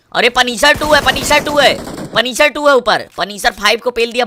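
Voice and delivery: loud, high urgent shout; excited callout. Real, not acted. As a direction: call out the enemy's position, loudly. Spotting the enemy, calling out.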